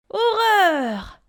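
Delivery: high, loud cry of horror; panicked high yelp; terrified cry. A narrator's reading. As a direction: shriek out the horror. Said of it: "s'écria le loup".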